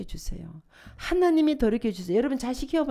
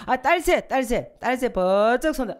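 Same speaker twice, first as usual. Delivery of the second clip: rally the mothers to raise their hands; excited call-out; 5 dB louder than usual. Real, not acted. Preacher calling out to the congregation, loud and cheering.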